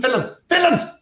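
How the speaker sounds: high, rising cries at the match; sudden high-pitched match reaction; urgent exclamation. Real, not acted.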